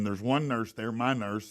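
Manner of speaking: normal talking voice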